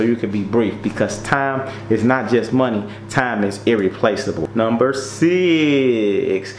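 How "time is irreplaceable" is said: ordinary talk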